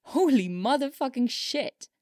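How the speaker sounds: loud, high burst of amazement; stunned outburst, high and loud; explosive release. A narrator's reading. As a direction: let it burst out after holding it in. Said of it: "let out an explosive breath".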